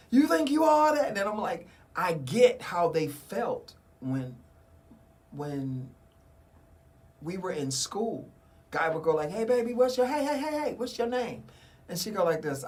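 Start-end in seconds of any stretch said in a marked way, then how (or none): 0.1-1.1 high, loud sassy taunt; mock taunt. Friends' retold taunt. 9.3-11.4 higher, eager come-on; mock pickup line. School guy hitting on girl.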